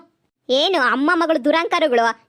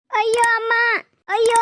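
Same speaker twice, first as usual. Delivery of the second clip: cry of pain; 5 semitones higher than usual. Acted. Woman knocked down, hurt hip.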